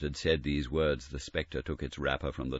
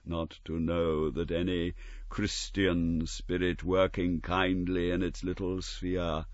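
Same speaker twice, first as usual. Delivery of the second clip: lamenting ghost; 5 semitones higher, 4 dB louder than usual. Acted. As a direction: mourn a wasted life as a spirit. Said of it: "cried the phantom".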